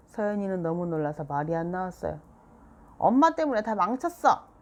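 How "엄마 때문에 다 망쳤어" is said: put-on louder, higher angry child; upset child. A narrator's reading. Seoyeon blaming mom for ruining her drawing, angry. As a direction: blame her, hurt and furious.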